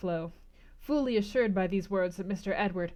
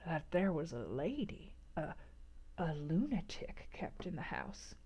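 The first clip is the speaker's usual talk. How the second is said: hushed whisper, much softer; speaking low; 3 semitones lower, 10 dB quieter than usual. A narrator's reading. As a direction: lean in and whisper the secret. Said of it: "speaking low".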